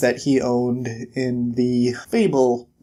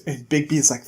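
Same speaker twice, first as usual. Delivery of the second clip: ordinary talk, nothing marked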